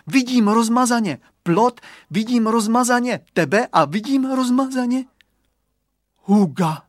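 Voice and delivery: high, loud excited listing; jubilant girl. A narrator's reading. Girl announcing what she can see, jubilant. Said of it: "zajásala Kateřinka".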